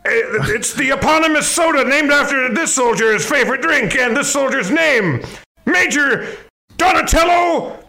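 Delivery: barking military commander; drill sergeant. Acted. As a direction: shout orders like a drill sergeant. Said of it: "I'm Major Melon".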